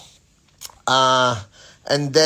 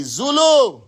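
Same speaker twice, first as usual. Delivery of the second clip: name shouted high and loud; excited call-out; 9 semitones higher, 5 dB louder than usual. Real, not acted. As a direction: greet the name with a burst of energy. Hype shout-out to a viewer.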